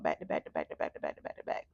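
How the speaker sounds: fast, low run of words; low quick-fire listing; rapid-fire repetition. Retold or imitated. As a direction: rattle it off to pile up the count. Piling up hundreds of emails.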